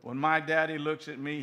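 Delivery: ordinary talk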